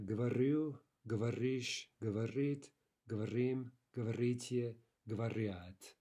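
говорю, говоришь, говорит, говорим, говорите, говорят, each stressed on the ending. Shown as a demonstration. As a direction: recite говорю to говорят as separate forms, stressing ю, ишь, ит, им, ите, ят. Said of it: "That means in stress".

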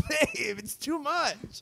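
very high, drawn-out mock plea; mock overwhelmed squeal. Retelling a plea to babe, high mock squeal. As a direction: squeal it slowly, laughing into the words. Acted partner overwhelmed by tickling, popcorn.

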